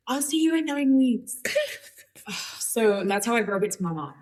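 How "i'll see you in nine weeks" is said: high, loud acted goodbye; raised-pitch mock breezy goodbye; retold phone sign-off. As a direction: sing out a quick farewell to mom. Retold hurried sign-off to mom.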